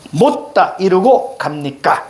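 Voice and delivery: "못다 / 이루고 / 갑니까" slowly, pausing after each word; broken into chunks. Shown as a demonstration. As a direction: read "못다 이루고 갑니까" word by word, slow and deliberate. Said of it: "여기까지 끊으시고". ("못다 이루고 갑니까"를 어절마다 끊어 천천히 읽기)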